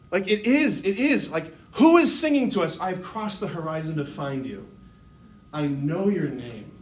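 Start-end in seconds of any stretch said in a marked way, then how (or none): 2.8-6.7 low, soft spoken lyric; sincere, tender recitation. Acted. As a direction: speak the song words quietly and earnestly. Song lyric respoken sincerely as Jesus's.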